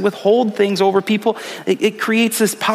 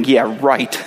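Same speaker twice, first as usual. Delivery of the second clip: sceptical; 6 semitones lower than usual. Retold or imitated. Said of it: "some of you are thinking".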